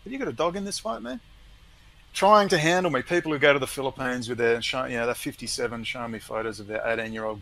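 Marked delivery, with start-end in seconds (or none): 0.0-1.2 quiet retort. Retold or imitated. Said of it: "And I said".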